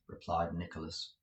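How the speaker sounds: usual speaking voice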